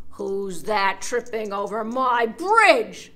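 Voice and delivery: loud, slow troll bellow. Acted. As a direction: boom it out like a troll.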